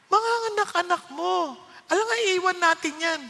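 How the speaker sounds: lightly voiced wife, matter-of-fact; mimicking wife.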